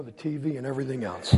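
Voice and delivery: ordinary talk, nothing marked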